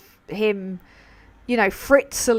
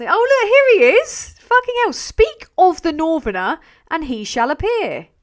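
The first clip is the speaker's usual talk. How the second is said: much higher, louder delighted cry; excited greeting; 8 semitones higher, 8 dB louder than usual. Real, not acted. Host greeting her arriving friend with a loud delighted cry.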